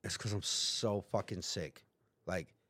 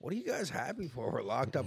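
baffled; 4 semitones higher than usual. Retold or imitated. Baffled at happy cellmates.

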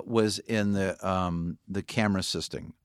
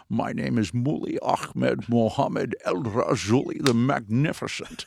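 put-on slow, grand full Scottish accent; Sean Connery Scottish accent; 4 dB louder, slower than usual. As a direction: announce the pirate's long name in a slow, rolling Scottish accent. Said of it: "With a full-on Scottish accent".

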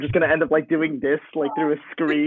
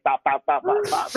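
imitating gunshots; 12 semitones higher than usual. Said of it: "he's just like".

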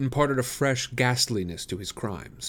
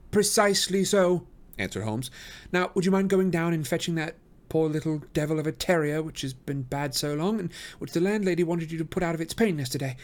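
high, assured detective; Holmes, brisk; 6 semitones higher than usual. A narrator's reading. Holmes to the doctor, high and assured.

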